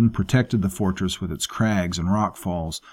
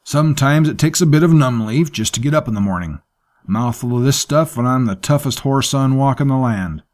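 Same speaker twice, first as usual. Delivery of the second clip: a hint of a boastful rough sailor, higher and louder; tough sailor Mackett, boastful; 6 semitones higher, 6 dB louder than usual. Mackett brags to the crew, lightly voiced boastful tough guy. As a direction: brag about chewing numb leaf, lightly voiced.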